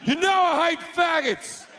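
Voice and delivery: God losing it at the party; angry God yell. Acted.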